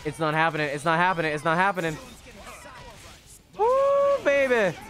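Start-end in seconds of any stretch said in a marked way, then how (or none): 3.6-4.8 very high joyful whoop; excited whoop. Real, not acted. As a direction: cheer it out. Celebrating a hit.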